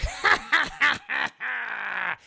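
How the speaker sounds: loud, staccato villain laugh; evil cackle with a long tail; goblin cackle. Acted. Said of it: "the Dafoe voice because I like it better".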